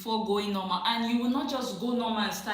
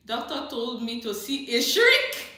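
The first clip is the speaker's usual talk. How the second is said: amused outburst; 3 semitones higher, 9 dB louder than usual. Real, not acted. Reads viewer comment, breaking into laughter.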